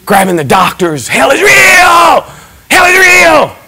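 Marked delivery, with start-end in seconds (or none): 1.1-3.6 high, slow yell; impassioned shouted warning; urgent shout. Retold or imitated. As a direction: grab the doctors and cry out. Grabbing the doctors, shouting.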